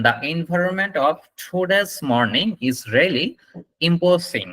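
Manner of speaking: slow model sentence, "today's" starting with ঠ; aspirated T in "today's". Shown as a demonstration. Teacher modelling the longer line for students, slow and careful. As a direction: slowly, puff the T of "today's". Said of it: "ঠ দিয়ে উচ্চারণ করবেন".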